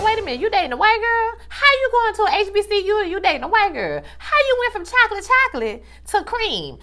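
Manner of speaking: high-pitched scandalized mom voice; shocked mock mother. A mother scandalized by her son's girlfriend, high and shocked. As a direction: squeal it like a mother who can't believe it.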